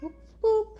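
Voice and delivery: high, loud playful boops. Real, not acted. Owner booping her cat's nose, high and loud. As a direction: boop the cat's nose with glee.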